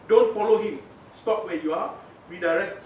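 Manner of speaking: a coach's loud don't; firm warning. Real, not acted. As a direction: stop them cold, say it hard. Coach warns against being led.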